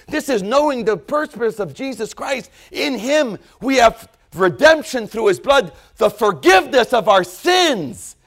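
high, loud, soaring revival preaching; full-force preaching. Real, not acted. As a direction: build it into a shouting altar call. Preaching climax.

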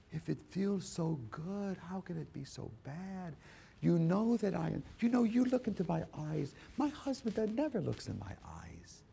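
soft, high, coaxing voice; seductive temptress. Acted. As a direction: purr like a temptress.

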